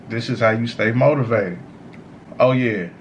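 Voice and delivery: ordinary talk, nothing marked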